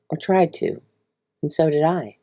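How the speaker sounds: plain reading voice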